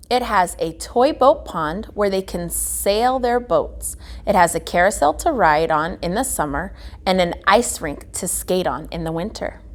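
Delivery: usual speaking voice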